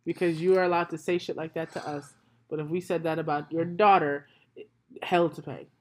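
low, soft, controlled telling-off; stern reproach. Real, not acted. Guest confronting co-host over double standard, low and stern. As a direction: drop the voice and lay it out seriously.

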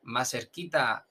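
ordinary delivery

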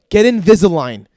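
loud mock order; contemptuous command. Dentist tossing pamphlet on patient's chest, contemptuous. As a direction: throw it out as a harsh command.

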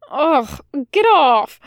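a half-hearted "get off" to a hugging friend; complaining. Acted. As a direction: fake annoyance hiding a smile. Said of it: "Crowley complained".

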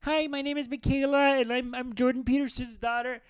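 put-on high, girly introduction; hammed-up daughter saying hi; mock daughter voice. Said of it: "he's like".

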